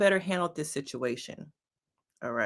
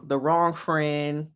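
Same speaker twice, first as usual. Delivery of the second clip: ordinary talk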